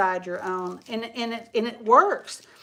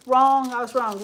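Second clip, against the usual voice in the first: blurted, much louder; loud self-correction; 8 dB louder than usual. Real, not acted. Owning up to viewers she was wrong, loud. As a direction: announce your own mistake with a loud burst. Admits her own mistake.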